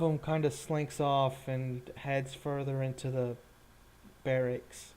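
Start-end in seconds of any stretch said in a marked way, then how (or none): none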